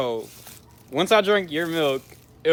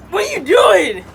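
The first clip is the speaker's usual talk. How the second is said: pained shout; 13 semitones higher, 9 dB louder than usual. Real, not acted. In pain, demanding.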